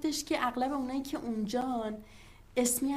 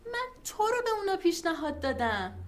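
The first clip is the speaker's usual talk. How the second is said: excited; 6 semitones higher, 5 dB louder than usual. Acted. Excited news to colleague.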